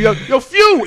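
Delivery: loud high shout; yelling across the room. Retold or imitated. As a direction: call out hyped across the crowd. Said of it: "he yells across the room".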